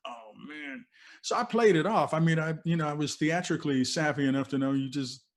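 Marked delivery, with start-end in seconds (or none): 0.1-0.8 hushed, high-pitched sinking feeling; retold dismay. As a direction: relive the dread under your breath. Reliving his dread after on-air slip.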